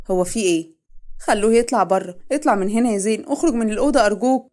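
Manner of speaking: loud, urgent pleading to leave. Acted. Mother begging son to leave the crying wife, loud plea. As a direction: beg him out over the noise. Family rushes in at the shouting.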